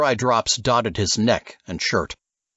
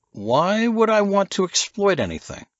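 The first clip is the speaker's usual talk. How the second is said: higher, incredulous question; lightly voiced protest; indignant Nichols; 11 semitones higher than usual. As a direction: push back at Silas. Nichols challenges Silas.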